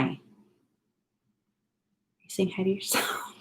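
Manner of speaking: soft hushed whisper. Real, not acted. Mom whispering to her son in the mirror, hushed whisper. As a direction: breathe it to the little one.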